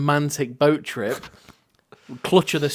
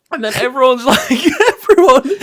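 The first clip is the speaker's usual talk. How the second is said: laughing; 9 semitones higher, 6 dB louder than usual. Real, not acted. Still laughing in the retelling.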